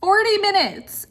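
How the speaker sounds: high, loud, slow exasperated cry; exasperated outburst. Real, not acted. Stuck in traffic forty minutes, venting to viewers, loud exasperated cry. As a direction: let the frustration burst out, slowly.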